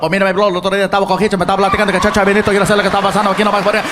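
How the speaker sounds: racing, nonstop Puerto Rican Spanish; rapid Puerto Rican. Retold or imitated. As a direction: rattle it off like a time limit. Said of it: "like there's a time limit".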